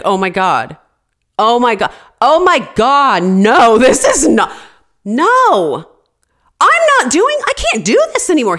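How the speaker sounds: building from loud to shrieking despair; despairing outburst. Retold or imitated. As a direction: break down at the news. Husband sick again at 1 AM.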